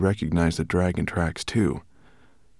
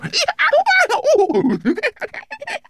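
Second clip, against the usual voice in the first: loud, high booming laugh; 8 dB louder than usual. Acted. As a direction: let out a big booming laugh. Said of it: "laughed such a loud, booming laugh".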